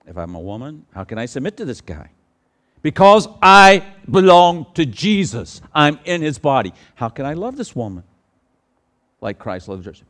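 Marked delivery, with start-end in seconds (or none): 2.8-6.7 loud, high, slow preaching; fervent sermon climax, loud and slow; preaching at full force. Acted. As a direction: proclaim it to the whole church.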